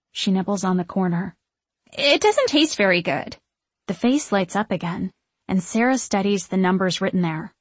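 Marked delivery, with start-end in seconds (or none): 1.9-3.4 high, naive witch voice; high-pitched, airy complaint; flighty witch, disappointed. A narrator's reading. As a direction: report it with childlike distaste.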